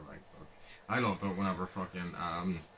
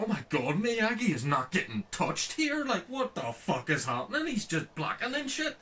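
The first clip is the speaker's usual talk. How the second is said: rising high, astonished voice; amazed disbelief; 10 semitones higher than usual. Retold or imitated. Fan watching Miyagi fight Terry Silver, astonished and high.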